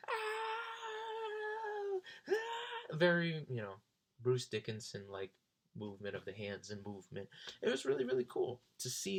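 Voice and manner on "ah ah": very high mock rock wail. Imitating [name] singing on stage, high wail. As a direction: wail it like a singer letting loose.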